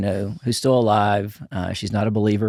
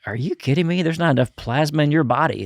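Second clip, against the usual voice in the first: high, louder astonished mom; mom in disbelief; 6 semitones higher, 4 dB louder than usual. Retold or imitated. Mom shocked at her son's savings, high astonished voice. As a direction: play the shocked mother. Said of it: "she was just like".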